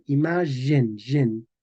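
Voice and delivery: Imagen as i-MA-zhen, then gen as zhen; medieval pronunciation. Shown as a demonstration. Teacher drilling for students, medieval pronunciation. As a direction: isolate the zh syllable gen. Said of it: "tenían el sonido j".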